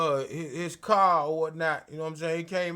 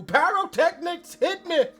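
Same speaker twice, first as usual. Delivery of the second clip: mock pyro hype; 6 semitones higher, slower than usual. Imitating pyro entrance.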